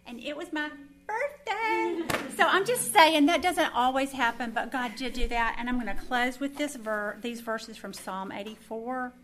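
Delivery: loud, lifted joyful announcement; loud happy testimony; excited. Real, not acted. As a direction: proclaim the good news. Joyful birthday news.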